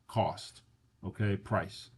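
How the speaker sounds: normal talking voice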